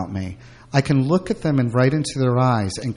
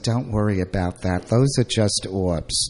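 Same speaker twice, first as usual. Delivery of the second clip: put-on Brooklyn accent; 5 semitones lower than usual. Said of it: "in a Brooklyn accent".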